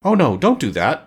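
protesting, a touch dismayed; alarmed exclamation. A narrator's reading. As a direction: object with mild alarm. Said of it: "exclaimed the Tin Woodman".

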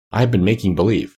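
usual speaking voice

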